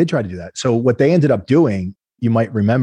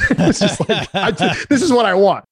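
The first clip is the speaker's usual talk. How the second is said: laughing mimicry; 9 semitones higher, slower than usual. Laughing while mimicking investor.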